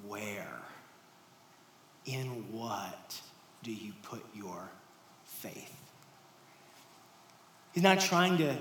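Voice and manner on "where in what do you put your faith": soft and slow, fading to a whisper; soft questioning. Acted. Jesus asks disciples where their faith is, softly. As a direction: ask it gently, almost breathing it.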